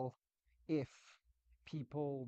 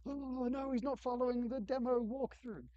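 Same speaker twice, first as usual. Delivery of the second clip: very high, louder panicky team voice; mock cringing dismay; 11 semitones higher, 5 dB louder than usual. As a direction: imitate horrified developers fretting helplessly. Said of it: "cringing and dying inside".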